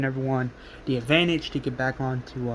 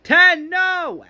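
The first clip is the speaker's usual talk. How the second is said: high, loud cry of alarm; retold child's scream, pitched way up; 14 semitones higher, 6 dB louder, much slower than usual. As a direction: scream to stop a friend. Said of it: "A J screams".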